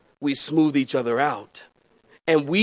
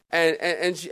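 ordinary talk

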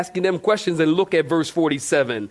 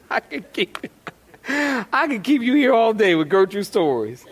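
normal talking voice

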